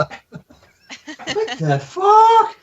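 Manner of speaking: high, slow, drawn-out squeal; furry voice. Pretend. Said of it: "You have to do the furry voice".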